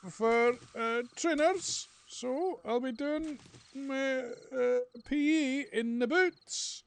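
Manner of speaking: put-on high, loud childish pleading; schoolboy explaining to the teacher why he has no trainers; whiny younger self. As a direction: squeak it like a sulky schoolboy. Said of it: "And I'd say".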